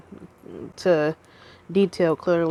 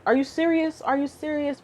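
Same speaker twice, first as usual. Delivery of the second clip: mom; 7 semitones higher, 3 dB quieter than usual. Retold or imitated. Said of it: "his mom was like".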